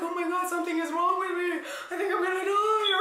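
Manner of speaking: hammed-up fear of dying, giggling; laughing mock panic. Woman telling friends she thought she'd die, laughing panic. Retold panic from pill withdrawal.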